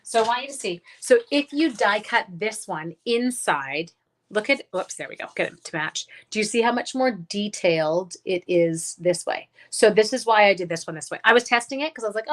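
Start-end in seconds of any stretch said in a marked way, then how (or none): none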